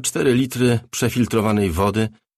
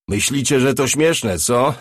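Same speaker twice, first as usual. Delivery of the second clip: loud, higher mocking voice through a megaphone; amplified voice; 5 semitones higher, 5 dB louder, slower than usual. Attackers taunting the trapped crew over a loudspeaker, amplified and mocking. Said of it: "Słyszeli ten sam wzmocniony głos".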